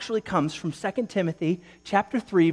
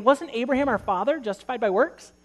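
higher, pressing challenge rising to the end; pointed rhetorical question; 7 semitones higher than usual. Retold or imitated. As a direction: hold it up as a challenge. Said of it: "like James is saying".

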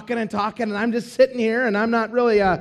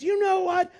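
high, insistent mock know-it-all; loud passenger voice; 9 semitones higher than usual. Seatmate lecturing the preacher on church history, loud and insistent. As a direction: imitate a talkative seatmate, pitched well up. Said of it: "That same voice, loud".

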